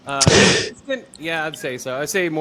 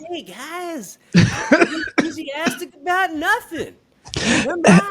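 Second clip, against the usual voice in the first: much higher, fake-peppy influencer voice; mock TikTok reviewer; 5 dB louder, slower than usual. Mocking TikTok reviewers to his co-host, in a high fake-peppy voice. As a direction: overdo the fake enthusiasm, pitched way up. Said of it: "it's all TikTok podcasts now".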